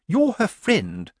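high, slow accusation; accusing. A narrator's reading. Lettice blaming Nanny for her friend, accusingly. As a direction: state it slowly as an accusation. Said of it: "her tone suggesting that this was as much an accusation".

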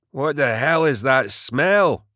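high, slow disgusted wail; wailed complaint. A narrator's reading. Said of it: "Forbin wailed".

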